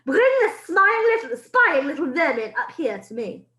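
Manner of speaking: put-on a witch demanding the boy be brought up; sneering witch. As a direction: sneer at the little spy. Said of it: "shouted the Grand High Witch".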